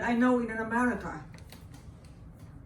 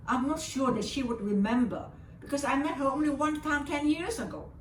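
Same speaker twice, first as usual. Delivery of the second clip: ordinary delivery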